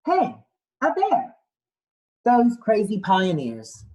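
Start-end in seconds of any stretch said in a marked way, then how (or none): 0.0-1.3 lightly voiced explorer, much higher exclaiming; a hint of an excited sailor; Captain Cook. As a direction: call out brightly as the explorer spotting a bear. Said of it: "Captain Cook said".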